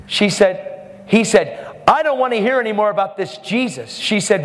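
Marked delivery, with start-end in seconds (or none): 1.9-4.0 high, insistent complaint; retold angry objection. Attendant to nurse about Jesus, mock angry objection. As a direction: cut off all discussion in a raised pitch.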